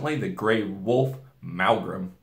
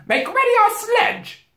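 high, loud, slow command; witch giving orders; 9 dB louder than usual. A narrator's reading. Witch ordering her servant, high and commanding. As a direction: ring it out imperiously. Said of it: "ordered the witch".

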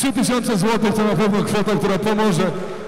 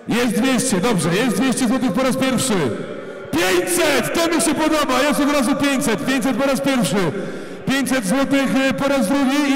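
auctioneer; 4 semitones higher than usual. Real, not acted. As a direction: call out each bid like an auctioneer. Auction calls rising bids.

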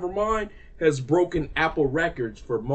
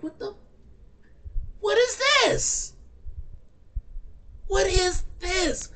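very high, slow, drawn out; disgusted disbelief at the track; high slow shocked question; stunned disbelief; slower than usual. Real, not acted. Shocked at the new song.

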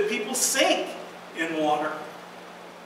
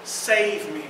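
ordinary talk

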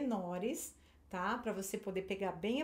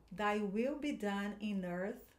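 slow, careful pronunciation; teacher modelling for repetition; slow model line; slower than usual. Shown as a demonstration. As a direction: read slowly for echoing. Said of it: "eu vou falando as frases".

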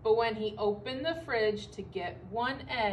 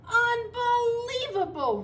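loud, high, drawn-out outburst; outraged rumble; 12 semitones higher, 7 dB louder, slower than usual. A narrator's reading. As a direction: stretch it out in loud disbelief. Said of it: "rumbled Bear".

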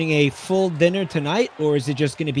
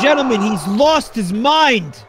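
big announcer call; 4 semitones higher, 3 dB louder than usual. Pretend. Commentator telling the crowd he's lost his mind, announcer style. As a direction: draw out each phrase for the crowd.

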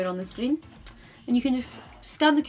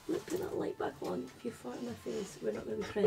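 whisper; 11 dB quieter, faster than usual. Real, not acted. Whispered aside to the dog.

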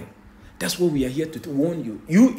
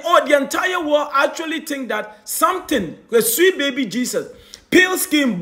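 forceful raised preaching; street preaching; 6 semitones higher, 3 dB louder than usual. Acted. Preacher hammering his listeners, preaching hard.

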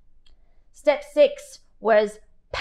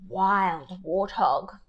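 hushed awe; 4 semitones lower, 7 dB quieter, slower than usual. Acted. Reading a tempting menu.

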